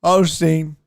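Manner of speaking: loud, slurred heckler shout; drunk heckler. Retold or imitated. Drunk man interrupts a comedian about Osteen, slurred shouting. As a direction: holler it out, words smeared with drink. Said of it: "He's drunk".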